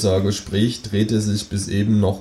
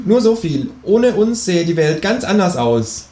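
viel höhere, freche Figurenstimme; Känguru-Stimme; 10 semitones higher, 4 dB louder, slower than usual. Acted. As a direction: das Känguru hoch und keck sprechen. Känguru spricht. (hohe, kecke Känguru-Stimme)

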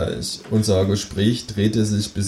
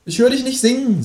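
high-pitched kangaroo question; expectant kangaroo. Acted. Kangaroo waits for the narrator's oath, high prodding creature voice. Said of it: "sagt das Känguru".

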